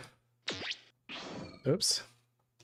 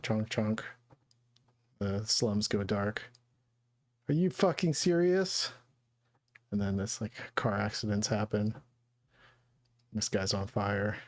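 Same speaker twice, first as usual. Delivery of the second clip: ordinary talk, nothing marked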